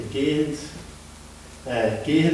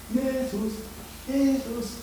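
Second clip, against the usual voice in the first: soft distant calling; from far away; 7 semitones higher, 7 dB quieter, slower than usual. Acted. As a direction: call as if heard from a distance. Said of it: "zuerst von Weitem".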